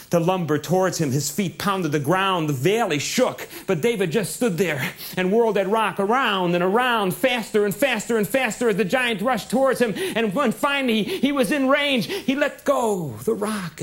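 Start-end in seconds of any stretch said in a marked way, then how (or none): 3.6-13.8 building excitement. A narrator's reading. Storyteller builds to climax.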